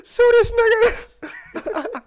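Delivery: very high slow shrieking yell; outraged shriek. Pretend. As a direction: screech it in mock outrage.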